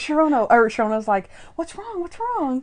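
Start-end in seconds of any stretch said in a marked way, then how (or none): 1.6-2.6 high-pitched imitated worried question; mock alarm. Co-host plays Sharona running over to Monk, alarmed. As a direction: ask it high, in pretend concern.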